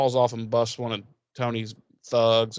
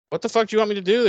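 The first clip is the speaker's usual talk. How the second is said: high, loud exasperated retort; mock exasperated yell; indignant outburst; 10 semitones higher, 5 dB louder, much faster than usual. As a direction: throw it back at her, fed up. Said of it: "he's like".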